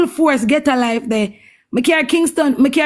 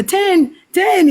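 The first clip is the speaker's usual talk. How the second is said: higher-pitched imitation of a little boy; lightly voiced child calling out; imitating child; 6 semitones higher than usual. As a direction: mimic the child's call in a raised voice. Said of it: "Him say".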